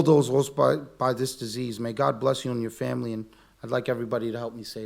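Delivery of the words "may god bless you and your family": ordinary talk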